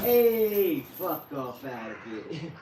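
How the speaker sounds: a loud hey, then a low, slowed fuck off out of here; tough New Yorker act. Acted. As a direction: bark it like a movie tough guy, then drop low and slow. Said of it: "That was acting. I was playing a role there".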